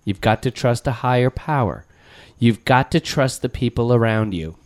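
a hint of a sincere speech. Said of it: "he did this entire speech".

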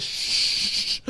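unvoiced hushing shh; a breathy shush; mock shush. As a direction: imitate her shushing him. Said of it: "She goes".

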